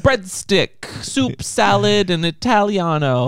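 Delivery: put-on silly singsong Italian accent; mock Italian. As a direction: ham up an Italian lover listing food. Joining in the fake Italian bit.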